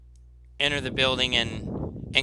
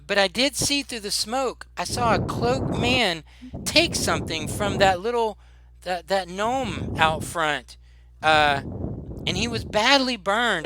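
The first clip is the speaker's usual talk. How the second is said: higher-pitched, halting eyewitness account; woman shopkeeper recounting; 5 semitones higher than usual. Acted. Shopkeeper tells the child what she saw in the blast, woman's voice. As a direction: act the woman witness, voice lifted. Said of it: "she says".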